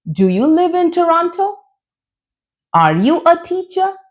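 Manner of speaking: TORONTO and TEACHER climbing high at the end; rising yes-no question. Shown as a demonstration. Teacher asking yes or no questions, rising intonation. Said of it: "when you have to ask a yes, no question".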